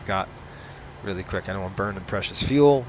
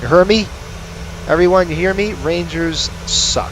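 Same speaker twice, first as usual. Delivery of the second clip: loud, pitched-up rant; shouting at everyone; 6 semitones higher, 4 dB louder than usual. Real, not acted. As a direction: yell it to the whole room. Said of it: "yelling at everyone".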